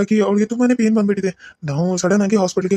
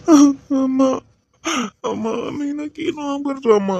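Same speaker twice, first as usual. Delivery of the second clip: higher, tearful whimper breaking into sobs; crying; 4 semitones higher, slower than usual. Acted. Husband to his mother, crying over his wife. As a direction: choke out the fear for her.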